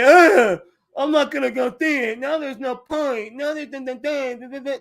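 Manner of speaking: mocking a dramatic fan, loud and high at the start, then humming dun dun; hammed-up drama-channel voice with dun-dun sounds. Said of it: "people like".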